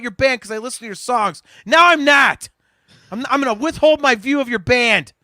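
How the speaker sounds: high, loud hammed-up tirade, yelling; comic shouting fit, way over the top; mock furious rant. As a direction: play up the outrage at the Purgles. Mock rant about the Purgles.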